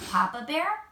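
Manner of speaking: ordinary delivery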